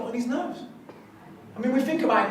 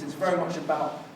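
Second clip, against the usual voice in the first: ordinary talk, nothing marked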